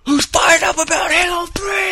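very high, drawn-out rallying yell; full-throated mock arena shout; imitated hype-man shout. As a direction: rev up the crowd like a hype man. Imitating a hype man rousing crowd.

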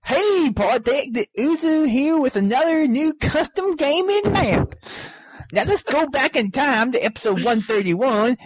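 lightly voiced hillbilly accent. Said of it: "I'll do the hillbilly accent".

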